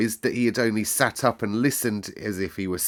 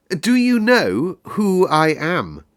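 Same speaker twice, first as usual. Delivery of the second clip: put-on higher, slow, haughty boy demanding, stress on do; slower and more lordly demand; more imperious; 9 semitones higher, 8 dB louder, slower than usual. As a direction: draw it out like a royal command. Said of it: "still more imperiously".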